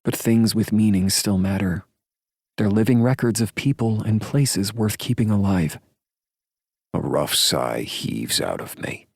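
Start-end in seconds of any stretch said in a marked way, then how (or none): 0.0-5.8 higher woman's voice, earnest; female character voice. A narrator's reading. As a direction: voice her sincerely, pitched up. Heroine's dialogue, higher voice.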